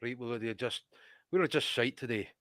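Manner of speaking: a low, soft mock Scottish accent; a grumbling Scottish manager, done quietly; understated Scottish accent impression, low and soft; Scottish accent as David Moyes. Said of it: "Give me the Scottish accent".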